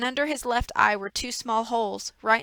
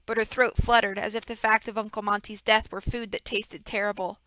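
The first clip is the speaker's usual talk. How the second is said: ordinary delivery, nothing marked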